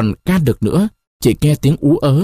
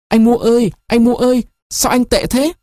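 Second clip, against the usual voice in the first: put-on girl's voice, much higher, begging; louder, pleading call; 11 semitones higher than usual. A narrator's reading. Girl begging her lover to wake, pleading high call. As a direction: plead with him to answer, high and urgent. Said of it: "gọi to hơn, khẩn khoản hơn".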